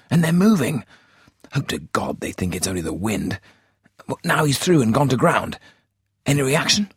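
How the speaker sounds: a spotter hissing then crying out as the runner moves; excited. A narrator's reading. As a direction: rattle it off, fighting to keep his voice down. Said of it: "began talking excitedly".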